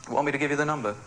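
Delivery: ordinary delivery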